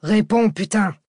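sudden furious yell; shouting. Acted. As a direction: bark it out in rage. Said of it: "Cortès hurla".

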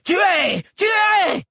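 high, loud screamed command; shouted kill order. A narrator's reading. Chado orders his beasts to kill, high loud scream.

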